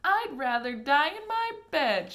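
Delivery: put-on high, loud stubborn old-woman shout. A narrator's reading. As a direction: refuse loudly from the bed. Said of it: "shouted Grandma Josephine".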